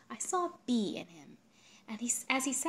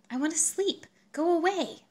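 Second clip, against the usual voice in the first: angry; 10 semitones higher, 9 dB louder than usual. Acted. Angry refusal at night.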